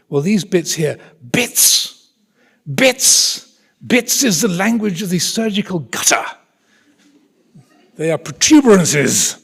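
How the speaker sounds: high, loud, pompous public school military English; posh English accent. Retold or imitated. Consultant correcting a trainee's vulgar word, in posh English accent. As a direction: scoff at the word bits in lofty posh English. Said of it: "I'm not exaggerating his accent".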